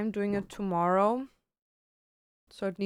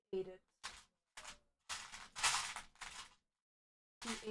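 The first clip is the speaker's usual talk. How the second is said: soft, mournful mumble; quiet sulk; 7 dB quieter than usual. Real, not acted. Grieving her eaten cake in a soft mumble. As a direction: mumble it, heartbroken over cake.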